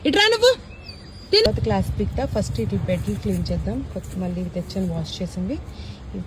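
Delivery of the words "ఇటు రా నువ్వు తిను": calling sharply. Real, not acted. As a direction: snap the dog over to you. Scolding the dog to come.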